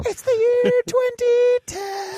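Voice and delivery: high mocking voice. Running gag, repeated line.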